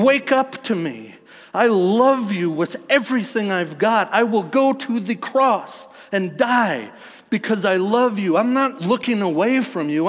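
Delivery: loud, high cry of devotion as God; impassioned plea. Acted. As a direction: call out urgently with full preaching force, easing at the end. Voices God's passionate plea to listeners.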